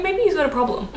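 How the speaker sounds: high, mock-casual shrug of a line; mock Australians mildly concerned. Australians finally admitting a heavy drinker might have a problem, said mock-casual and high. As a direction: lift the pitch and play it as an understated afterthought. Before they're like.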